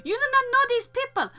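high, fast sneering imitation of a scolding boss; mocking bossy manager. Imitating the shitty manager scolding her for crying, high and fast. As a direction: mimic the manager who mocked your grief. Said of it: "that's what she sounded like".

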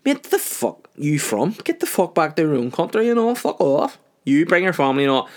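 high-pitched xenophobic rant; mock hostile mechanic. Garage worker abusing customer.

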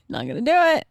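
high-pitched, tight self-restraint; strained composure. Retold or imitated. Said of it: "trying to keep my calm and my cool".

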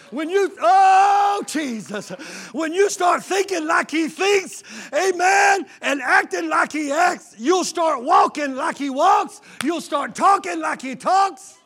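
high, loud, slow climactic preaching shout; preaching at full force. Acted. Preacher shouting to the church at the climax. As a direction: build the congregation up, crying it out.

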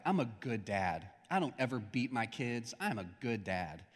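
mild smug dad brag; smug bragging. Retold or imitated. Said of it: "they're bragging".

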